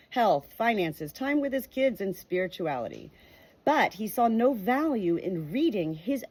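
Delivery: reading aloud. Acted. Sharing a book's introduction with her viewers, in a clear read-aloud voice. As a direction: read the book's words out to your viewers, evenly paced.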